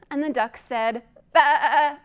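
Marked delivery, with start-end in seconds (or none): 1.3-2.0 loud sheep bleat, ba. A narrator's reading. Duck tries to bleat, loud baa. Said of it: "as loud as he could".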